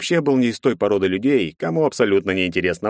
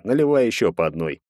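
ordinary delivery

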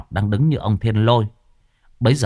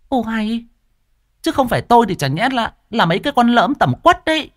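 scolding wife; 12 semitones higher than usual. A narrator's reading. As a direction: tear into the two fools. Wife indignant retort.

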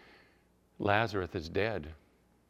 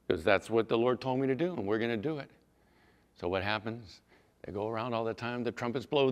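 usual speaking voice

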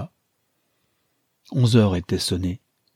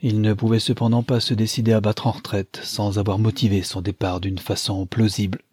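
usual speaking voice

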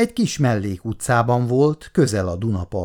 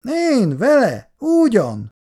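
dismissive; 13 semitones higher, 4 dB louder, slower than usual. A narrator's reading. Icu shrugs off the concert.

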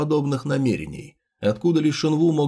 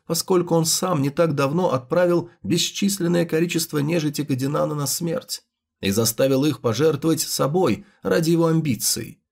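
usual speaking voice

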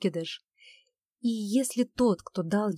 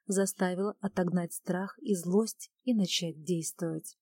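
usual speaking voice

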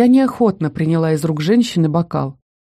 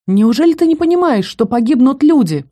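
indignant outburst; 6 semitones higher, 3 dB louder than usual. A narrator's reading. Olivia furious at Elsa.

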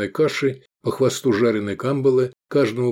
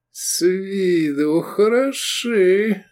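high, very slow drawl; 9 semitones higher, much slower than usual. A narrator's reading. Owner knocking the price down, drawling. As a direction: stretch it out doubtfully to haggle. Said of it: "протянул он, сбивая цену".

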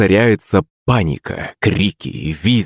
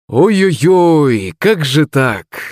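high, drawn-out mock lament; tutting dismay; 8 semitones higher, much slower than usual. A narrator's reading. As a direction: stretch out each word in theatrical regret. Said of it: "зацокал маг".

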